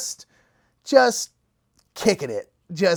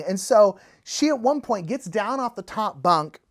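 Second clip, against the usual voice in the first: ordinary talk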